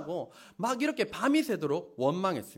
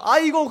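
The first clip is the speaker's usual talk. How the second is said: loud, higher cry of grief; wailing aigo; 7 semitones higher, 9 dB louder than usual. Shown as a demonstration. Preacher demonstrating funeral wailing again, high cry. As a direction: wail like at a funeral. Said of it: "그만 하는데도 눈물이 막 맺힙니다".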